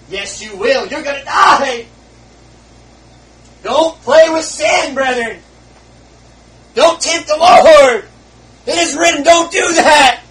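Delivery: high loud slow shout; shouting warning. Real, not acted. Preacher shouting warnings at the brethren. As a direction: roar the warning at them. Repeated line escalates to shouting.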